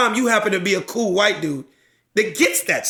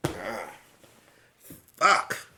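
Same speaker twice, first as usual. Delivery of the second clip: torn deliberation; 5 dB quieter, much slower than usual. Acted. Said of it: "I start going like this".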